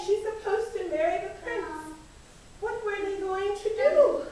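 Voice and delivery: hammed-up worried wail. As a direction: wail it like the frightened court. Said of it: "everyone was worried and everyone was crying".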